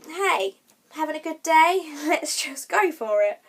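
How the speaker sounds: high, loud, playful pep talk; gung-ho excitement. Retold or imitated. She psychs herself up for sight reading, gung-ho and excited. Acts out her gung-ho thought.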